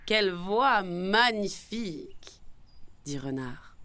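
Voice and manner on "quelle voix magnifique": slow, drawn-out gushing praise; honeyed fox praise; flattering admiration. A narrator's reading. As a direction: stretch out the compliment like a sly flatterer.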